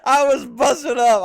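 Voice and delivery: loud high laughter; laughing. Real, not acted. Laughing at clip.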